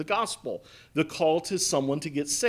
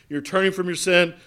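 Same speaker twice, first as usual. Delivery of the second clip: normal talking voice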